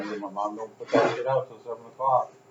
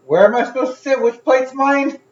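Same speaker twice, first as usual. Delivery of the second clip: very high, loud imitation of a complainer; mock complaint; 13 dB louder than usual. Imitating mom asking where to sit, loud mock complaint. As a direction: wail it out, pitch way up.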